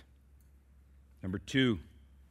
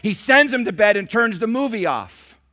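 higher and louder, building grievance; indignant complaint; 9 semitones higher, 11 dB louder than usual. Retold or imitated. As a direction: vent like the fed-up wife. Wife venting about husband.

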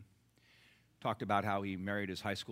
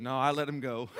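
louder, laughing through the words; 6 semitones higher, 8 dB louder than usual. Real, not acted. Preacher delivering the punchline laughing. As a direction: laugh as you say it.

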